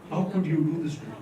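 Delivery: very soft, quick, low reproach; hushed hurt question. Retold or imitated. Wife unleashes the seven-word question, hushed. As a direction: imitate a wounded wife, barely audible. Wife's unanswerable question, first take.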